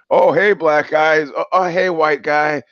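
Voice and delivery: high, slow, stilted mock hello; hammed-up greeting, like a badly acted movie; mock awkward greeting. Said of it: "It's like a, a, Bo-, a Tommy Wiseau movie".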